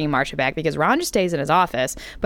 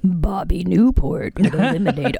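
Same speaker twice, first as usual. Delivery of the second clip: mock campaign ad; 2 semitones lower than usual. Teasing friend with a mock campaign ad, hushed. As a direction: whisper it like a smear ad.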